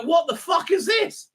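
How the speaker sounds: an outraged shout at a terrible scene; incredulous shout. Retold or imitated. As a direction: yell it at the screen in disbelief. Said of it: "Every time I look at that, I go".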